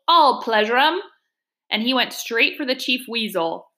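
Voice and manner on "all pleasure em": mild acted yell, pitched up. A narrator's reading. Toad charges the Chief Weasel, mild taunting yell. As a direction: fling it out as a restrained battle taunt. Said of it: "he yelled".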